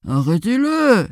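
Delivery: high, drawn-out cow call; cow's cry. A narrator's reading. Cow cries to the farmer for help, drawn-out call. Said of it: "cria la vache".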